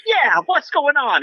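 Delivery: high, drawn-out anime hero shout-out; hammed-up excitement, pitch soaring; super excited. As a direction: burst in like a dubbed anime protagonist. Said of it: "super excited".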